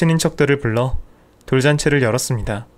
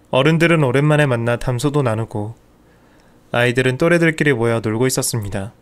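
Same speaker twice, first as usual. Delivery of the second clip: ordinary delivery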